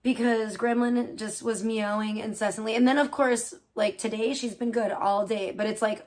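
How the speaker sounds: ordinary delivery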